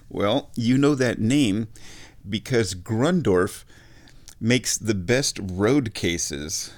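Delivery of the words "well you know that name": normal talking voice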